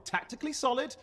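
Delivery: high, cocky brag; cheeky self-praise; smug boast. Acted. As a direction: sell the mess as a triumph. Boasting about messy run.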